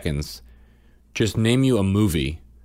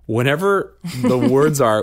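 normal talking voice

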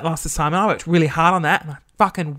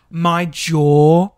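ordinary talk, nothing marked

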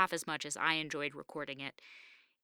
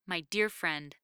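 usual speaking voice

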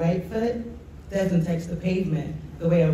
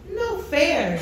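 high indignant cry of no fair; a kid shouting that it's unfair; yelling protest; 8 semitones higher than usual. Acted. Kiki protests the race result, high yelling cry. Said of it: "Kiki yells".

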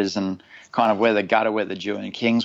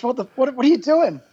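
higher, indignant what-are-you-doing; dad's confused outburst; 12 semitones higher than usual. Retold or imitated. Dad surfaces and confronts the diver, pitched up in disbelief. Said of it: "My dad hits the surface and goes".

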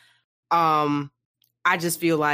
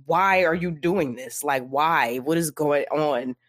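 slow pleading; slower than usual. Retold or imitated. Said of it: "she's just like".

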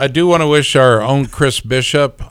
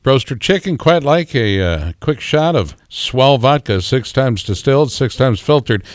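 ad read; 4 semitones higher than usual. Acted. Reads a vodka ad.

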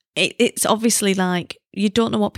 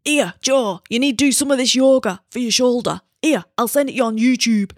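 slightly raised, put-on voice of a sports massage guy; imitating massage guy; 4 semitones higher than usual. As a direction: mimic how the massage guy really talks. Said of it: "he didn't say it like that".

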